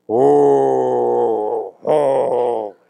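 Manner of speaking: loud drawn-out mock groan of dismay; a long anguished oh; pained cry. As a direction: cry out as if wounded. Preacher cries out in dismay.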